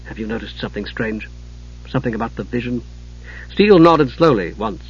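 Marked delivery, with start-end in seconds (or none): 0.0-2.8 hushed and lower, much softer. Acted. Commander confiding in Steele, low hushed voice. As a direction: pull him aside in a hush. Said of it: "keeping his voice low".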